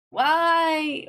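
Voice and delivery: high, loud despairing why; exasperated cry. Real, not acted. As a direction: wail it out.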